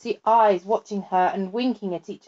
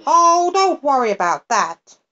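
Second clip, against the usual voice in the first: higher, louder careless creature voice; the fly-by-night dismisses the girl's fear, high and breezy; fly-by-night crying out; 6 semitones higher, 6 dB louder than usual. A narrator's reading. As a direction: brush off her worry, high and carefree. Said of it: "cried the fly-by-night".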